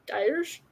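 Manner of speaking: "Dirge" as DIE-urj with a rising question; questioning pronunciation. Shown as a demonstration. Reader tests a word, "Dirge" said DIE-urzh, rising. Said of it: "No idea".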